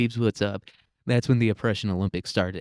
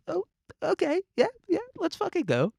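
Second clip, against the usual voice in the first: squeaky falsetto mock cheer; mock hype fan. Mock cheering vaccine fans.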